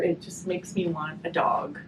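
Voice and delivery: ordinary delivery, nothing marked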